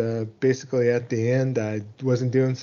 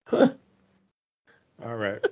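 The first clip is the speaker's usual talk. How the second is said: ordinary talk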